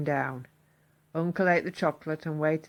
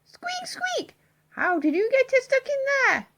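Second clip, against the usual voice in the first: high whispered squeak, then high louder question; alarmed squeaky mouse; 4 dB louder than usual. Acted. Missus Mouse finding Mister Mouse in the tube, high alarmed squeaky voice. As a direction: squeak out in dismay.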